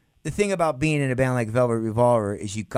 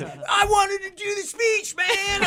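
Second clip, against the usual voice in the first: much higher, slow indignant shout; pissed-off bandmate; slower than usual. Retold or imitated. Bandmate complaining he lost his speech, mock furious yell. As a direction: yell it like he missed his big moment. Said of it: "he's all pissed".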